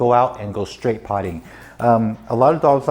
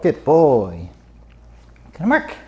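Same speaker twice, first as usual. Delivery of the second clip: coaxing the dog; 3 semitones higher than usual. Real, not acted.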